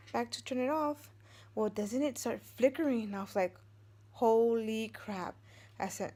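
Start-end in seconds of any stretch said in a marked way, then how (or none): 4.1-5.3 slow, stunned holy crap; drawn-out shocked gasp; slow shocked exclamation. Retold or imitated. Light starts flickering, fright.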